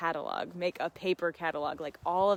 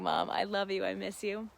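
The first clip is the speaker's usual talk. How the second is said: ordinary delivery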